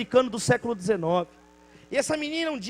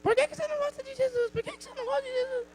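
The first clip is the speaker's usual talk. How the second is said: put-on much higher, quick child voice in his head; echoing child voice; 13 semitones higher, 4 dB quieter, faster than usual. The girl's question echoing in the neighbor's head, high child voice. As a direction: let the girl's question haunt him. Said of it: "ficou com a frase na cabeça".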